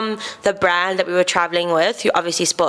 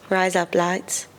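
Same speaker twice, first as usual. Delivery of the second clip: Australian accent; 4 dB quieter, much slower than usual. Shown as a demonstration. Showing friends the Australian wordplay, softly, in an Australian accent. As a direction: Rise up, lights in a soft Australian accent, sounding like razor blades. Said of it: "in an Australian accent".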